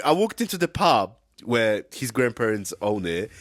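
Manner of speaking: ordinary talk, nothing marked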